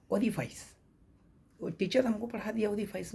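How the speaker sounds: ओरिफाइस ending like "face" or "fice" with a long i; wrong pronunciation of orifice. Retold or imitated. Teacher recalls what an instructor taught, ओरिफाइस mispronounced with "-fais". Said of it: "जबकि ओरिफाइस गलत word है".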